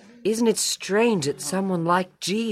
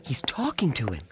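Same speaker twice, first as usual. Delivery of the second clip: hushed whisper while spying; 5 semitones lower, 7 dB quieter than usual. Acted. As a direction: whisper so nobody overhears. Secretly watching Jesus at pool.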